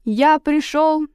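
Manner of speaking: slow, loud declaration; passionate proclamation. A narrator's reading. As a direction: proclaim it again. Savage repeats proclamation.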